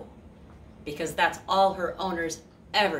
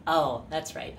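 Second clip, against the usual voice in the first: ordinary delivery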